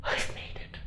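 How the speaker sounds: whispered to himself; hushed inner voice; inner thought whispered. Retold or imitated. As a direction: breathe it out in disbelief. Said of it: "I'm thinking".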